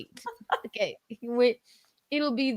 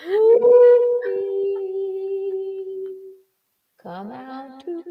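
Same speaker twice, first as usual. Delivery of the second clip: creepy sing-song call; 13 semitones higher, 5 dB louder, much slower than usual. Pretend. Calling Ruthie again, louder.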